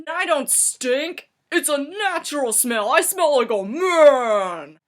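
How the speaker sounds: high, loud mock indignation building to a shout; drawn-out, bellowed comic protest; defiant protest. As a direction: proclaim it with hammed-up pride. Friend protests he doesn't stink.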